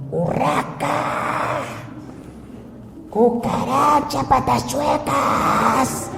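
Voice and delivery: hammed-up drunk husband hurling names, high and slow; mock drunk yelling, high pitched and stretched. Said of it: "gritándole".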